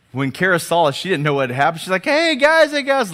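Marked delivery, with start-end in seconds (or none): 2.0-3.1 very high, loud chirpy call; cheery greeting. Retold or imitated. Wife greeting her bedraggled family, unaware, high mock cheer. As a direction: imitate an oblivious, delighted hello.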